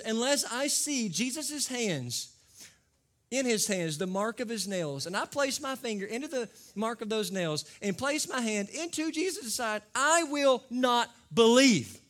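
a lightly voiced doubting Thomas refusing to believe; stubborn Thomas. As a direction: dig in your heels. Thomas voiced demanding proof.

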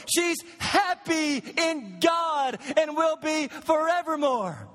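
building pulpit fervor, shouting the last line; full-force preaching. Acted. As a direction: drive the hope home from the pulpit.

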